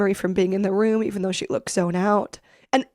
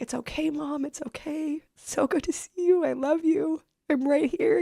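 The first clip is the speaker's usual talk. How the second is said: tender comfort; 6 semitones higher than usual. Retold or imitated. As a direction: soothe her mother gently. Comforting mom tearfully.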